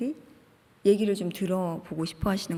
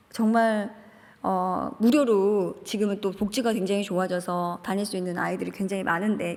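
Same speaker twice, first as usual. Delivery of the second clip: ordinary delivery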